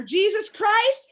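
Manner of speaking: high, loud, slow plea to Jesus; shrill drawn-out prayer; exasperated prayer. Retold or imitated. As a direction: beg heaven for patience. Praying for calm.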